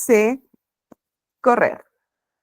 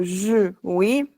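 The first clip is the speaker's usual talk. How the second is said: ordinary delivery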